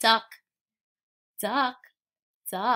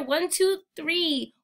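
plain reading voice

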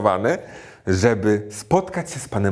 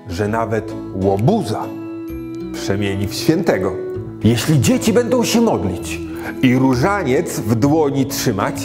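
put-on rhythmic rhyming verse for children; poem recitation. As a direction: recite it like a nursery rhyme.

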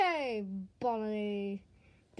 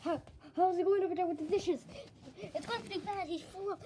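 ordinary delivery

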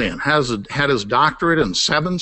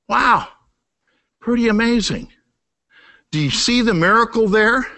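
ordinary talk, nothing marked

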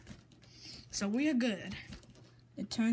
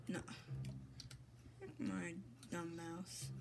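normal talking voice

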